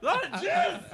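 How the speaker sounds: high, loud words through laughter; can barely get it out for laughing; laughing hard. Real, not acted. Friend cracking a crude joke, high and laughing.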